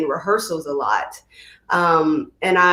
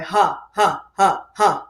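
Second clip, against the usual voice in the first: voiced huh pulses, low in the chest; low vocal exercise huhs. Shown as a demonstration. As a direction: demo of a low vocal warm-up. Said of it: "exercises that have me going low".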